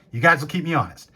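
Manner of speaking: ordinary talk, nothing marked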